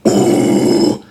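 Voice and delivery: breathy low growl; low growl scream. Shown as a demonstration. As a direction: growl the ooh low from the diaphragm. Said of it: "they'll be like".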